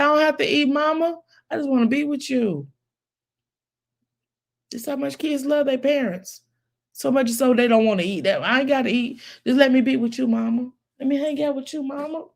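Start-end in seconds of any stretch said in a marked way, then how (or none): 0.0-2.7 put-on a child's higher pleading voice. Child pleading with his mama. 8.4-12.3 put-on a child's higher pleading voice. Child pleading with his mama.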